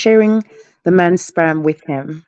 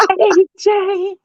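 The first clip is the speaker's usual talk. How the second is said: high squeaky voice; chipmunk-high calling out; helium voice; 12 semitones higher than usual. Pretend. Squeaky voice game.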